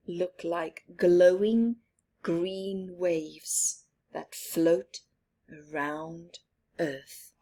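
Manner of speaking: ordinary delivery, nothing marked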